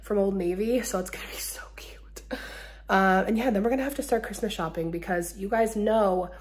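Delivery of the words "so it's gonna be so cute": whispering. Real, not acted. Excited secret aside.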